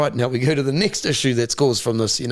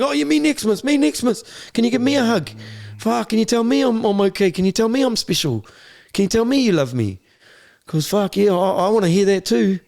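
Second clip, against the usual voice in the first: put-on high, pleading little boy begging for comfort; needy kid voice; 9 semitones higher than usual. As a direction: beg like a lonely kid in a boys' home. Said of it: "we would have all been going".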